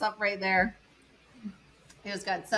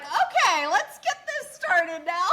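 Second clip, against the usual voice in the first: hurrying things along; 8 semitones higher, 3 dB louder, slower than usual. Retold or imitated. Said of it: "and Joan was like".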